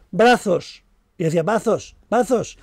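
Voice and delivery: mock toddler demanding to be carried. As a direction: whine for brazos like a stubborn little girl. Little daughter demanding to be carried.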